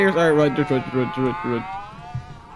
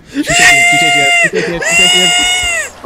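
panicked screaming; 10 dB louder than usual. Real, not acted. Chased by demon.

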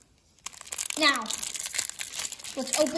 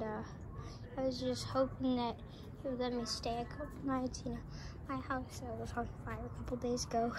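low, very soft, hesitant voice; 3 semitones lower, 8 dB quieter than usual. Acted. Homeless neighbour asking to stay, shy and hushed. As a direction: mumble it timidly from across the street. Sorry I talk very slow.

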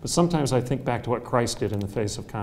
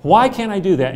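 loud, high challenging why; loud mock-indignant why; indignant demand; 6 semitones higher, 8 dB louder than usual. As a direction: throw the question back at them. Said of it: "And I'm gonna say".

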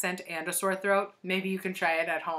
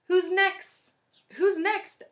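high, louder, sneaky teacher voice; high sly teacher; 12 semitones higher, 5 dB louder, slower than usual. A narrator's reading. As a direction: call it out slyly. Teacher calling on class.

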